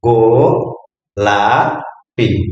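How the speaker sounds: slow teacher demo of গোলাপি; drawn-out slow word. Shown as a demonstration. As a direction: stretch গোলাপি very slowly, syllable by syllable. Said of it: "প্রথমে".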